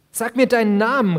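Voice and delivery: loud, high demand; loud, high demand for his name; calling out. Acted. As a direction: shout the demand. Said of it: "rief ich".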